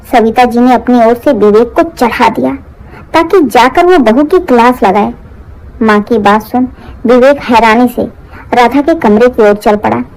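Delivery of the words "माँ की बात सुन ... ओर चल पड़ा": ordinary delivery, nothing marked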